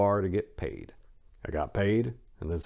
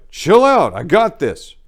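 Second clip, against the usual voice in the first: loud, high shout to calm him down; retold shout; 10 semitones higher, 12 dB louder, slower than usual. Narrator shouting back at his yelling boss, loud. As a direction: yell it back at a raging boss, then settle.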